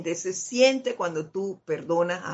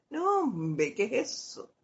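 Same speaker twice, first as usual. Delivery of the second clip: softer, offhand "no big deal"; dismissive brush-off; 3 semitones higher, 7 dB quieter than usual. Retold or imitated. Brushing off the apologizing friend, soft and offhand. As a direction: wave the apology off quietly. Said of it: "¿Y tú qué haces?".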